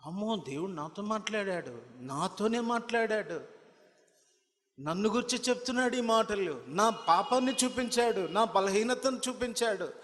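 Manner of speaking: high, loud fiery preaching; preaching at full force. Acted. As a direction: drive the rebuke home from the pulpit. Preacher voices broken heart. (ఉద్రేకంగా బిగ్గరగా ప్రసంగం)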